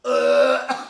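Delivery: loud high retching groan; mock vomit noise mid-restaurant. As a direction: act out throwing up loudly. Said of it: "stands up in the middle of the restaurant and goes".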